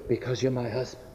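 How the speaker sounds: hushed, very soft reply; whispering. Acted. Wife confessing to her husband, whispering. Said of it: "She just whispered".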